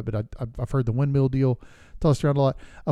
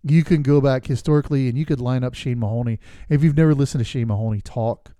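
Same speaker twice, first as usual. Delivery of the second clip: ordinary talk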